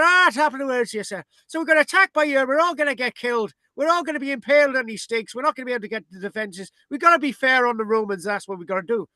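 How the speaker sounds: put-on high-pitched comic Irish accent; hammed-up Irish raider explaining the plan; playful Irish voice, pitched well up; mock Irish. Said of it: "So the Irish go".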